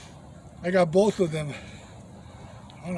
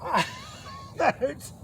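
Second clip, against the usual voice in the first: pained laugh, then a wince; real laughing ouch; laughing through pain; 7 dB quieter, slower than usual. Real, not acted. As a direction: laugh it off while it stings. Fish fin pricks his hand.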